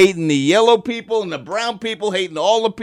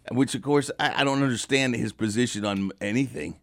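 usual speaking voice

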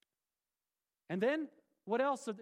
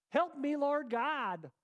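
mock plea; 6 semitones higher, 4 dB louder, much slower than usual. Mock plea about preaching bad ending.

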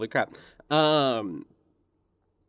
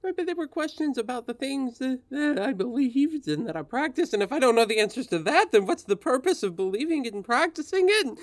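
fast, pitched-up anguished pleading; distressed high voice; 10 semitones higher, faster than usual. Acted. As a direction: rush the words, high and panicky. Spirit distressed, rushed.

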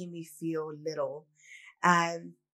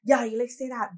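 higher and louder, a mock dismissive yeah yeah; dismissive brush-off; 6 semitones higher, 9 dB louder than usual. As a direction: wave him off with a scoff. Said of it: "I used to just".

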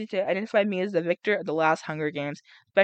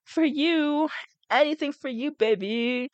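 mock Peeta; 6 semitones higher, slower than usual. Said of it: "He's like".

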